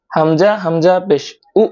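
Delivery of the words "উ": short উ stopped abruptly in the throat; glottal jolt. Shown as a demonstration. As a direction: give উ a little push, then cut it dead. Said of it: "ধাক্কা দিতে হবে". (উ ধাক্কা দিয়ে থামানো)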